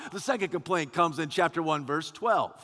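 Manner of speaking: ordinary talk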